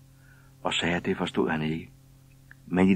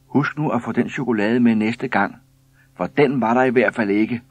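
loud, raised-pitch reminder; woman calling out; 6 semitones higher, 7 dB louder than usual. A narrator's reading. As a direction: call after the departing postman. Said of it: "råbte en af konerne".

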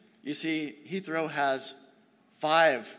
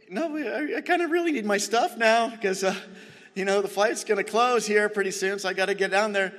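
high-pitched, louder polite insistence; mock-polite plea, pitched up and pressing; polite but firm; 6 semitones higher, 4 dB louder than usual. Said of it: "trying to be polite, but also firm".

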